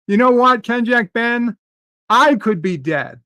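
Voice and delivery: loud, high, indignant comeback; indignant outburst. Real, not acted. Snapping back at a commenter.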